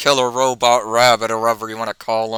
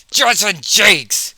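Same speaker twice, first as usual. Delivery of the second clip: high, loud yell of the name Judson Jakes; 7 semitones higher, 4 dB louder than usual. Acted. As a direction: shout the name to confront him. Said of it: "Rocket yells".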